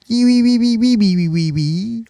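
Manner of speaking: high piping Kiwi squeal; mock Kiwi accent. Shown as a demonstration. Contrasting Kiwi with Australian, squeaky Kiwi accent. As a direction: run off squeaky New Zealand vowels. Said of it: "that's how you talk Kiwi".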